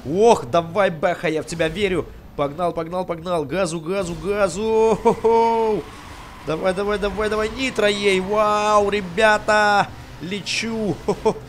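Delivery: loud, high cheering on the car; excited shouting. Real, not acted. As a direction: yell with racing excitement.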